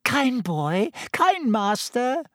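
high, wheedling flattery; fawning plea. Acted. Pettigrew crawling toward Ron, fawning plea. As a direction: grovel to the master, drawing it out.

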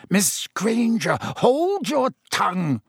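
high, slow, cutting order; angry rebuke. A narrator's reading. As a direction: silence a pupil sternly, drawn out. Snape silencing the girl.

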